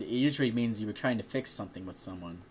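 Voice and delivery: usual speaking voice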